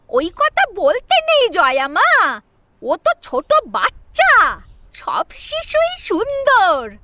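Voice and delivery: mild, tender mother's voice; gentle scolding mother. Acted. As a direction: coax the child out of being unkind.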